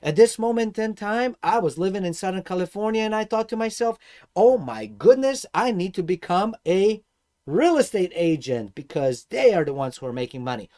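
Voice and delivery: ordinary delivery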